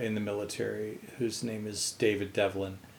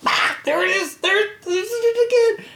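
a much higher, louder cry pointing it out; alarmed shriek; 8 dB louder than usual. Retold or imitated. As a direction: shriek as it darts past. Girlfriend sees the mouse again.

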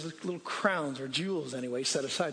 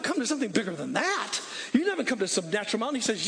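usual speaking voice

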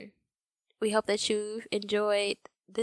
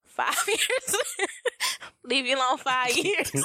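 laughing through the words; giggling out the words; 6 semitones higher, slower than usual. Real, not acted. Laughing while repeating.

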